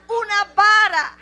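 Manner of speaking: loud, firm declaration; firm answer to the question. Acted. Answer repeated with rising force. ("Una vara" dicho fuerte y firme)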